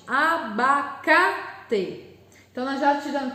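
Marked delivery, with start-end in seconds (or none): 0.1-2.0 "Abacate" very slowly split, leaning on CA; "Abacate" in drawn-out syllables, CA most prominent; stress on CA. Shown as a demonstration. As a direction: sound out A-ba-CA-te, making CA the strong syllable of "Abacate". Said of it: "a sílaba de tom mais forte".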